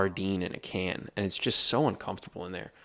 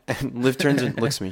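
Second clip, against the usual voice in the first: ordinary talk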